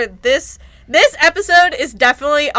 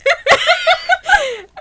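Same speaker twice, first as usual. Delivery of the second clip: high burst of laughter. Real, not acted. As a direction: cackle at the memory. Laughing about recording high.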